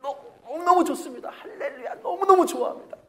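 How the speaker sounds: high, laughing delight; answered prayer, loud high joy; joyful. Retold or imitated. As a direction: burst with happiness.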